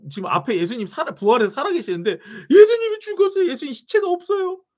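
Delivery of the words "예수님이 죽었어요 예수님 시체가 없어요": hammed-up weeping over the empty tomb. Pastor playing Mary crying to Jesus, high tearful wail.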